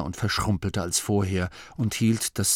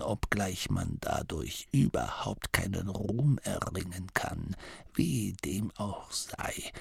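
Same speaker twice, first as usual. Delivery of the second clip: faint, softer rasped whisper; dying abbot's whisper; 8 dB quieter than usual. A narrator's reading. The dying abbot whispering a secret into Masklin's ear. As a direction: breathe it out like a frail dying old man.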